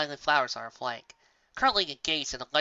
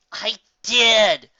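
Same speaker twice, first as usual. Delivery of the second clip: smug grin; 7 semitones higher, 10 dB louder than usual. Acted. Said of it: "obnoxious grin imaginable plastered across her face".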